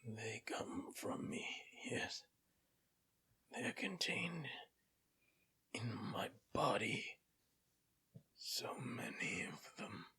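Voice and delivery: raspy whisper of a dying dwarf; guttural dwarf voice. Acted. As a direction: rasp it out like a withered corpse. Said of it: "guttural voice speaking in Dwarven".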